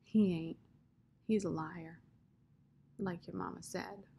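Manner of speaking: quiet, understated sorrow; sad. A narrator's reading. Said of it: "said Rob sadly".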